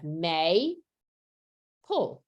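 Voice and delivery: "may, pull" as two separate syllables, long pause between; broken into syllables. Shown as a demonstration. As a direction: stretch "may", stop, then say "pull". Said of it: "how I would break the word into the two syllables".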